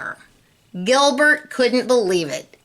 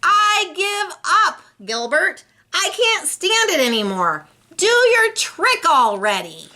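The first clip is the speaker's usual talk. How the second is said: exasperated shout; 10 semitones higher than usual. Acted. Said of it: "Lewis finally shouted".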